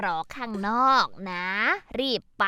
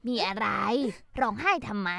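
ordinary delivery

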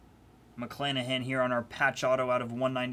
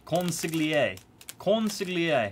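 loud mock Italian; 6 semitones higher, 4 dB louder, slower than usual. Loud mock mafia word.

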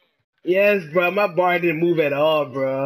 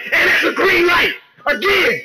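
high-pitched celebratory shout; real gamer hype, voice shooting up; so hyped; 8 semitones higher than usual. Real, not acted. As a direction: yell it as the shot drops. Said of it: "sorry for getting so hyped".

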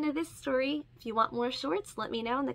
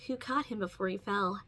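plain reading voice

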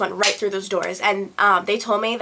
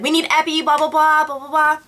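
louder, higher imitation of urgent doctor; frantic; 7 semitones higher, 5 dB louder than usual. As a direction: call out for help in acted urgency. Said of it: "is running around frantically".

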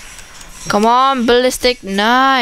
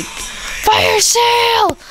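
mock announcer; 11 semitones higher than usual. Mock announcer calling power-up.

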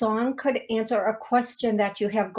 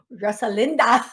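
"Rosalinda" in a louder mock accent, falling end; exaggerated accent; 5 dB louder than usual. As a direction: echo "Rosalinda" with an amused, overdone accent. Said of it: "accent come from?".

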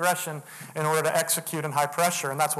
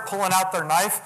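ordinary talk, nothing marked

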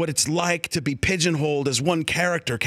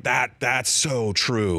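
touched; 5 semitones lower, much slower than usual. Retold or imitated. Dad slow, touched.

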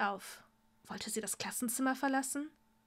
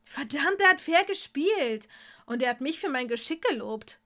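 angry shout back; 3 semitones higher, 6 dB louder than usual. Acted. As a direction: yell back in anger. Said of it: "rief Tom verärgert zurück".

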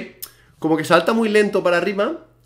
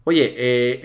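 ordinary delivery

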